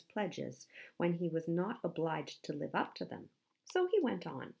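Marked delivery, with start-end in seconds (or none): none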